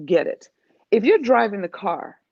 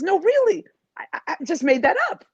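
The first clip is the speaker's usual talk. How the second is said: higher and fast, tumbling protest; excited insisting; 8 semitones higher, faster than usual. Retold or imitated. A coach insisting to her client she made it up, excited. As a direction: rush it out, eager to be believed. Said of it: "And I says".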